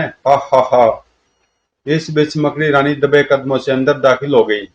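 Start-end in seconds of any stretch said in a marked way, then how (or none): 0.0-1.0 acted, spelt-out "ha ha ha"; measured mock laugh of a plotting villain; villain's laugh. Villain laughs after ordering killing. (खलनायक की बनावटी हँसी)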